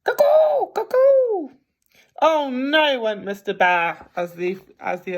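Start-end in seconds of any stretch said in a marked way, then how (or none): 0.0-1.5 very high, loud cuckoo clock call. Acted. Clock strikes in the living room, high loud cuckoo. 2.2-3.0 high, loud exasperated groan; fed-up Mr Bear. Acted. Mr Bear kept awake by snoring, high exasperated cry.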